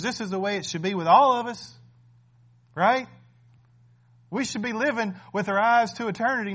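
a fervent sermon climax, loud and urging; preaching at full force. Acted. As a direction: drive the message home to the congregation. Pastor preaching with rising force.

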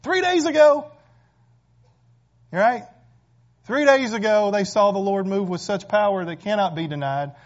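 shouting preacher at the climax; climactic preaching. Acted. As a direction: build to the peak of the sermon.

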